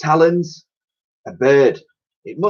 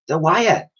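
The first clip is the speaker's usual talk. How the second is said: higher, pointed reminder; Dottie's voice; 6 semitones higher than usual. Acted. Dottie reminding Elsie about the wire, higher. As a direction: point it out with a wink.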